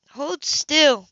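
a firm order to hold still. A narrator's reading. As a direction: snap the order out. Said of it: "Marlowe ordered".

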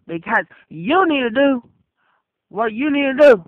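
usual speaking voice

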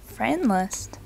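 usual speaking voice

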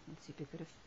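usual speaking voice

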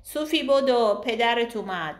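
loud call across the yard. A narrator's reading. Nina calling her daughter across the yard, loudly. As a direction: shout it to the child far away. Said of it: "بعد داد زد".

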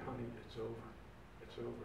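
calm a frightened husband; tender soothing. Retold or imitated. Wife soothing him at night.

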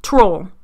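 "troll" as her botched version of twirl; mispronunciation shown. Shown as a demonstration. Podcaster showing what her attempt sounds like, plainly "troll". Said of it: "It sounds like I'm saying".

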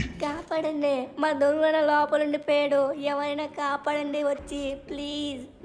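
soft, drawn-out pleading; distant cry for help. Acted. Scared boy begging passers-by to save his brother, faint distant pleading. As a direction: beg for someone to come, faintly from afar. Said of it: "చిన్న పిల్లవాడు అరుస్తున్నట్టుగా ఉన్నాడు".

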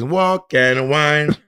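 slow, drawn-out Caribbean "Walk and Wine"; Caribbean accent. Retold or imitated. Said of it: "It's like".

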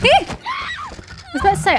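high slow shocked shout; startled shout. Real, not acted. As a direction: cry out in fright. Startled by noise.

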